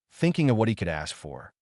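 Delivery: usual speaking voice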